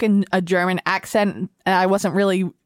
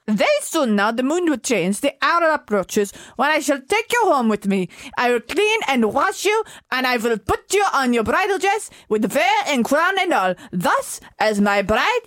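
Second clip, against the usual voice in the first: put-on botched German accent drifting into Indian; lilting mock-German with an Indian tinge; 2 semitones higher than usual. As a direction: attempt German, land halfway to an Indian accent. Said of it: "That was, like, German with India".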